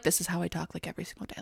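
imitating the date's monotone talk; mock monotone. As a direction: mimic him softly and dull. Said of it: "he acted like".

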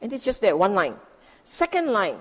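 normal talking voice

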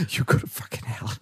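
angry words under the breath; whispered rant. Retold or imitated. Scammer whispering abuse at the caller. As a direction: hiss the curse quietly. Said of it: "he went off on me, but whispering".

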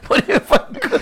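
high, breaking into laughter; laughing. Real, not acted. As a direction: squeak it out mid-laugh. Joking about census category.

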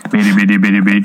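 put-on a light French-accented robot chirp; a lightly voiced Twiki chirp, Frenchified. Imitating Twiki from Buck Rogers in a light French accent. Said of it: "I said it with a French accent".

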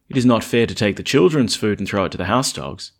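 loud, solemn reading voice; proclaimed gospel line. Acted. Priest proclaiming Jesus' words during the Gospel, loud and solemn. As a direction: declaim it to the congregation.

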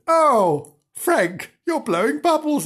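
high, loud put-on amazement; teasing, swooping cry of astonishment; mock astonishment. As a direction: gasp it out in exaggerated surprise. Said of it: "in mock astonishment".

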